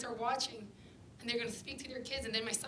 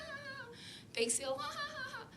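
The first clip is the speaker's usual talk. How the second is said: mocking fake laugh. Mocking cheerful bake sale laughter.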